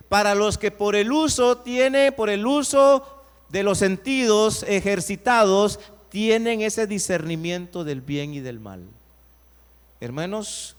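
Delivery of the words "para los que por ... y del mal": loud preached scripture reading; fervent loud pulpit reading; preaching at full force. Acted. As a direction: proclaim it to the congregation, loud. Preacher quoting scripture loudly.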